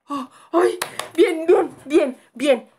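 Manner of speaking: loud, high startled repetition; yelp, then reassure yourself loudly; startled panic. Real, not acted. Player is startled in hiding, panicked yelps.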